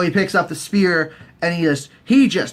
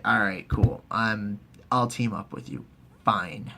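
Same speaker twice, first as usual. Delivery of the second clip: low, soft, reluctant concession; mock grudging agreement; 6 semitones lower, 7 dB quieter than usual. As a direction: give in under your voice.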